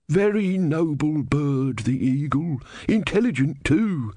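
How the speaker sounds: ponderously approve, as if nobody could stop you; boring elderly man. A narrator's reading. Elderly man praising the noble eagle, boring and unstoppable. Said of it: "in a kind of boring, unstoppable voice".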